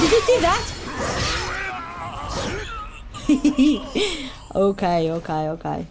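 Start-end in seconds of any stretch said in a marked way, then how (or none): 0.0-0.6 higher and brighter, bursting with pride; thrilled, proud shout-out; excited. Real, not acted. Thrilled after a big combo. 0.7-4.5 long whooping laugh; gleeful shrieking laugh; triumphant laughing shout. Real, not acted. Triumphant after a combo.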